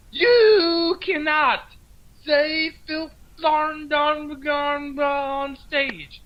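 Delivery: high, slow, drawn-out scolding. Retold or imitated. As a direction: lecture each word slowly in a high put-on voice. Imitating [name] scolding.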